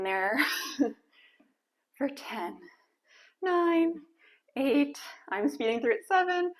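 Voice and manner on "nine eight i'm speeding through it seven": strained, straining to count; pushing through burning legs; after a workout. Real, not acted. Counting down while holding squat.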